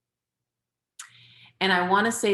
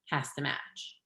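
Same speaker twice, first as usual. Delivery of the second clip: whispered emphasis; 3 semitones lower, 10 dB quieter than usual. Shown as a demonstration. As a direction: drop to a whisper on has to match. Repeats the rule, dropped to a whisper.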